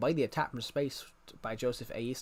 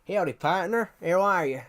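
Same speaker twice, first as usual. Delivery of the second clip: lightly drawled cowboy howdy; tip the hat, cowboy greeting; 4 dB louder than usual. Pretend. Putting on the cowboy hat, greets viewers in a cowboy voice.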